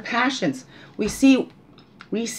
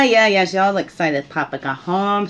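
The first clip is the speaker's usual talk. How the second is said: usual speaking voice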